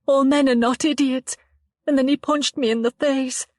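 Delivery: put-on a frightened girl telling it haltingly; shaken young woman Chloe.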